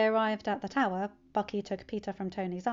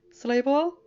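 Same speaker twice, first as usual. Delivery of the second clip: friendly uncle; 5 semitones higher, 8 dB louder than usual. Acted. Bruce greets Peter in kitchen.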